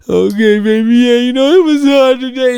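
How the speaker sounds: high, whiny mock confession; mock weepy boyfriend. Boyfriend giving in to his girlfriend, mock whiny. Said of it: "boyfriend giving in, voiced".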